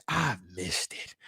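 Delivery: quiet, slow and heartfelt; softly savoring. Real, not acted. Streamer telling viewers he missed football, quiet and slow. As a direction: let it out like a fond sigh.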